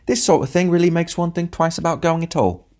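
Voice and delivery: high, prissy grumble meant to be overheard; a short scowling man complaining aloud; sniffy little man airing his displeasure; pointedly loud remark. A narrator's reading. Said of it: "rather unnecessarily loudly".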